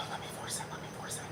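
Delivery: breathy whispered straining; mock strained whisper. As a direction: whisper it like forcing yourself. Acted straining under breath.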